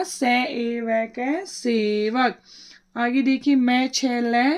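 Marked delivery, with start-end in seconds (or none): 0.0-2.4 very slow syllable by syllable, सै ए वै के, then सेवक; a teacher spelling सेवक out for pupils. Shown as a demonstration. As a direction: sound it out slowly to the class. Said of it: "क्या बन गया?".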